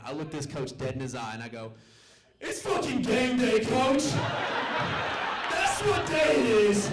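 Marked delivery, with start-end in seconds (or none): 2.4-6.9 high, tearful movie-speech shout; hammed-up triumphant yell through tears, drawn out. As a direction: bellow it like a dumb inspirational sports-movie climax. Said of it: "And through tears".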